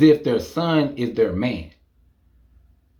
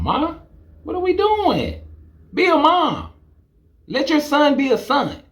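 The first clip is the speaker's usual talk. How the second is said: high, pleading exasperation; incredulous scolding; exasperated; 11 semitones higher than usual. Real, not acted. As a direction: beg her to just be a mom. Scolding the mom.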